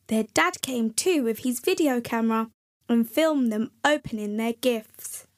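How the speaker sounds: usual speaking voice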